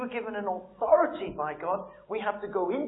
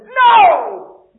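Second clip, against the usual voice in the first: sudden high, very loud scream; re-enacted scream of protest at full volume; 11 semitones higher, 18 dB louder than usual. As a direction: scream it out as one long, drawn-out cry. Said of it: "I screamed out, honestly, I said".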